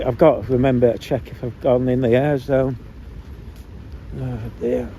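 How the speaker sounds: normal talking voice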